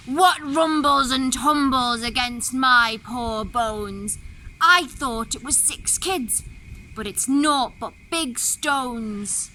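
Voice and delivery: slow, loud, groaning wolf voice; wolf crying out. Acted. Wolf complains of the stones in his belly, slow and loud. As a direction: moan it out like a heavy, aching wolf. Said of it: "Then cried he".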